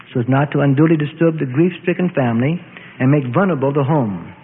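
usual speaking voice

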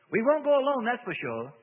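loud, high angry outburst. A narrator's reading. Demon defying the minister for the first time, angry. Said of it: "Lydimus seemed angry".

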